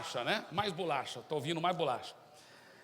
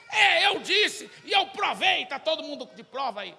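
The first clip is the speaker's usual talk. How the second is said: scornful shout; 9 semitones higher, 9 dB louder than usual. Acted. Student president yells back at Zé, loud scornful boast. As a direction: yell your proof at him.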